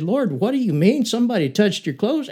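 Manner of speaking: high, disbelieving question; incredulous. Retold or imitated. Disciples doubting Jesus in the crowd, high incredulous question. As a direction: ask as if he can't be serious. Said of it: "the disciples were incredulous".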